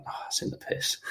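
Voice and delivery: whispered, dismayed aside to himself; under his breath. Retold or imitated. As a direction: breathe it out like a private curse. Muttering to himself after bad shot.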